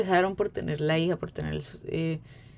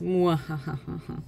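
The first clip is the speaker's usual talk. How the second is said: slow, low evil cackle; mysterious villain laugh; 3 semitones lower, 4 dB quieter, slower than usual. Acted. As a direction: cackle like a mustache twirling villain. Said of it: "esa risa misteriosa". (risa malvada de villano)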